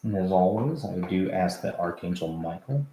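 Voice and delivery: quiet, low prayer voice. Real, not acted. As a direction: softly ask the archangels for protection. Prayer of protection before session.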